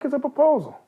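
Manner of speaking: high, baffled repeat of the word; confused echo of a word he has never heard; puzzled echo. Retold or imitated. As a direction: say it like a strange new word. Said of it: "I'm like".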